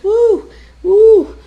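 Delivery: high, loud swooning woo; hammed-up fainting cry; faint woozy moan. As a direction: mimic a mother about to faint. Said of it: "I feel like I'm gonna faint".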